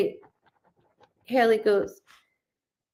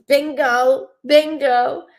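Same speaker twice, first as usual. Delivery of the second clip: raised, louder gleeful cry of bingo; excited bingo; 5 semitones higher, 4 dB louder, slower than usual. Retold or imitated. Medium realising the dog clue fits, excited. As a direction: call it out like you hit the jackpot.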